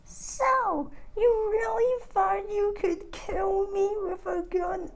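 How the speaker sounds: squeaky high, soft taunting voice; squeaky high villain sneer. Acted. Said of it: "she's like".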